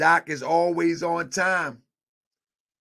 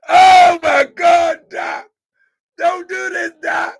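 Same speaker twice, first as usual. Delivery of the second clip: loud, high, half-laughing "oh my God"; exasperated outburst; 8 semitones higher, 11 dB louder, slower than usual. Real, not acted. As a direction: throw your hands up at the late doc. Host exasperated, laughing at late guest.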